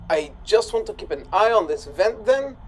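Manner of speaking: ordinary talk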